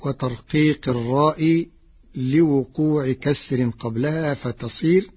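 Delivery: normal talking voice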